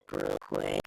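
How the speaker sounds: ordinary delivery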